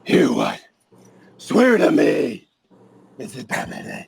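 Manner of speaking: Batman voice. Pretend. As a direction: growl it out like Batman. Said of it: "Let's hear it".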